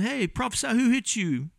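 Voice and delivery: high-pitched mock taunt. Acting out soldiers taunting blindfolded Jesus.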